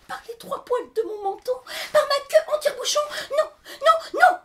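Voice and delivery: very high, fast piglet voice with a laugh; squeaky piglet. Acted. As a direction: squeal it like a cheeky little pig. Little pig answers the wolf.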